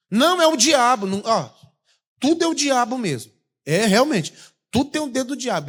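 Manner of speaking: high, loud mocking voice; mock excuse. Pastor mocks friend blaming the devil, high and loud.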